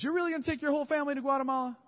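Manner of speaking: high, fast, disbelieving; can't believe what he's hearing; incredulous question. Retold or imitated. Stranger at church asks about the Guatemala move, incredulous and quick.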